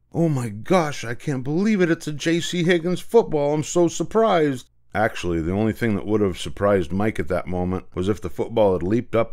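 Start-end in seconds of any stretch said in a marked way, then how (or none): 0.1-4.7 faked surprise. Mike faking surprise badly.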